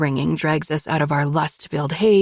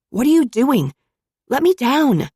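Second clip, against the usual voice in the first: higher, louder protest; demanding; 7 semitones higher, 3 dB louder than usual. A narrator's reading. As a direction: demand to be put down, indignant. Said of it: "I demand".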